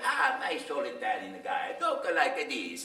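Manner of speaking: put-on slow old Italian accent, drawn out; slow, mild old Italian man accent; old Italian guy. Shown as a demonstration. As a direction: speak like a kindly old Italian man, slowly. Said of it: "he's like".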